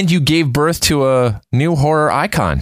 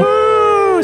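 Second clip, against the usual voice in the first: cheering woo; 3 dB louder than usual. Real, not acted. Host cheers Happy Halloween.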